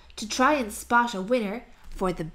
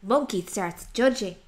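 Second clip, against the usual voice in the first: plain reading voice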